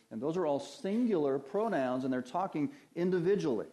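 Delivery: ordinary delivery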